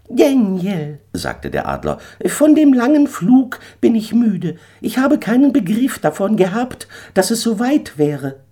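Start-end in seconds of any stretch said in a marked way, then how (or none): 0.1-1.0 much higher, loud bird voice; a proud eagle laying down terms; haughty eagle. Acted. Eagle urges Daniel off the sinking stone, high and haughty. 2.3-8.4 much higher, a touch tired bird voice; weary eagle. Acted. Eagle tells Daniel he is tired from flying, high voice.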